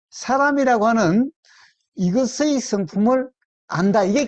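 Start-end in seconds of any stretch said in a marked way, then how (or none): none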